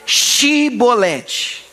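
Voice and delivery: "Shibolete" drawn out slowly with sh. Shown as a demonstration. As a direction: dwell on the sh in "Shibolete". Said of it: "Então fala aí". ("Shibolete" bem lento, com o sh)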